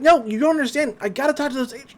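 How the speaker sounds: high-pitched mock whining protest; frustrated plea. Dipper arguing with Grunkle about agents, acted frustrated plea. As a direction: plead it high and urgent.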